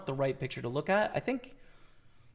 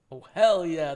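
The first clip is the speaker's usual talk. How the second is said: excited exclamation; 3 semitones higher, 10 dB louder than usual. Real, not acted. Excited reaction.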